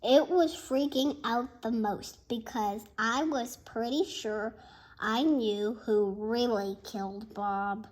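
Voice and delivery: plain reading voice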